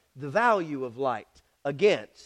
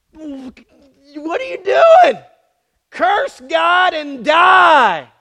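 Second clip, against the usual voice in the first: loud, very slow, higher shrill-free shout; wife shouting; 7 semitones higher, 7 dB louder, much slower than usual. Acted. Job's wife berating him, loud slow shout. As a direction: drag out the wife's scolding cry. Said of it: "his wife comes up to him and says".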